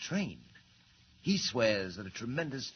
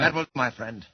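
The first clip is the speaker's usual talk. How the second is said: cutting him off, louder; stern, louder dismissal; sharp rebuke; 8 dB louder than usual. Acted. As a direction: shut the henchman up firmly. Villain cuts off doubting henchman.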